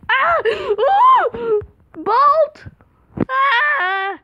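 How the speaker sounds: high, loud shrieks of alarm; real high-pitched panicked shout; startled screaming. Real, not acted. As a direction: scream and yell as you flee. Startled by pursuer, bolts away.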